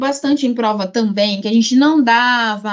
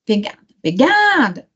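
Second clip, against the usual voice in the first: pegada, then peGAAAda with GA stretched and raised; calling the word. Shown as a demonstration. Teacher testing a word in the exam, calling it out. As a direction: try it, then call it out long on GA.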